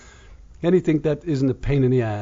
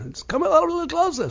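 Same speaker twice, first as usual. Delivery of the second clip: very high, loud coaxing call; teasing yell; 5 dB louder than usual. Retold or imitated. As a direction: lure them closer with a tease. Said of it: "she yelled over".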